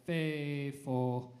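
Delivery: "Faithful" said firm and full; strong emphasis on "Faithful"; powerful. Shown as a demonstration. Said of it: "really be powerful and say".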